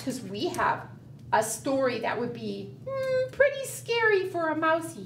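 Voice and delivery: ordinary delivery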